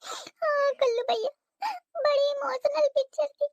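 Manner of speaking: high, tearful voice, words breaking into sobs; crying. Acted. Sister-in-law sobbing to Kallu over a sad film. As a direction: weep through the words like a teary bride. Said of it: "रोती रहा का?".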